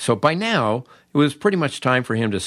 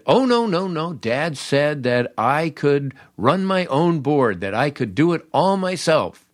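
raised, slowed mock-innocent claim; naive boast; 6 semitones higher than usual. A new DJ insisting to the station boss, mock naive. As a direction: act the clueless kid bragging.

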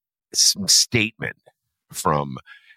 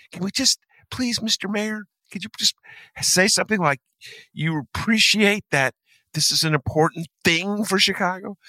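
high, wheedling begging voice; mock pleading; 13 semitones higher than usual. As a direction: beg meekly, pitched well up. Acts out team pleading with mayor.

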